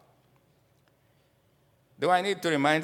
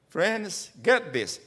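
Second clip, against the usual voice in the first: normal talking voice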